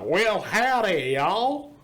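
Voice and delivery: hammed-up Texan twang; Texan accent. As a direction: stretch it out like a mock Texan. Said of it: "like, a Texan accent".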